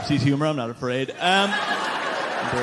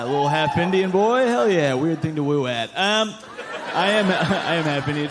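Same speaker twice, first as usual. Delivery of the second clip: ordinary delivery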